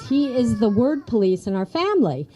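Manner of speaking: ordinary talk, nothing marked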